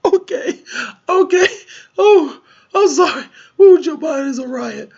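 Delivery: helpless high laughter that breaks into crying; can't get the words out for laughing; squealing tears-of-laughter voice; laughing fit. Real, not acted. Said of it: "I can't even say it without laughing".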